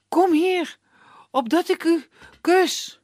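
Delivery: high, loud lovesick plea; lovestruck suitor. Acted. Iskander calls Elena to him, lovestruck and high. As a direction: beg her to come close, lovestruck. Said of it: "Hij zegt".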